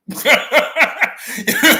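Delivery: loud burst of laughter; big laugh. Real, not acted. Speaker cracking up after swearing, loud laugh. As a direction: laugh at your own cussing.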